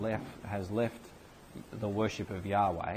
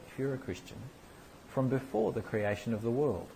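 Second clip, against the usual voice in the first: ordinary delivery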